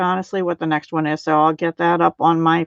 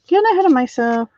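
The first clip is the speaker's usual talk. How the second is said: higher, fast singsong self-chiding; singsong repeat; 7 semitones higher, faster than usual. Real, not acted. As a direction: sing it back to yourself, quicker.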